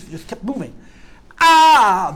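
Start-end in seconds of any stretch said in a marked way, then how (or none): 1.4-2.1 high, loud crying shout of Ah; imitated cry. Re-creating the Rebbe's cry, high and loud. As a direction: cry out the ah from the soul. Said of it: "the ah was like a shrei".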